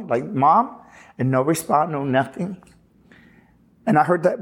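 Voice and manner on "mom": higher, rising "Mom?"; puzzled call with a steep upward lift; questioning call. Retold or imitated. Son asking whether his mom called him, questioning call.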